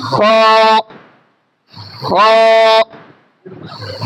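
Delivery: খ said thick and heavy from the back of the throat; heavy Arabic kha. Shown as a demonstration. Teacher drilling the letter for students, heavy খ. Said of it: "মোটা করে উচ্চারণ করতে হবে".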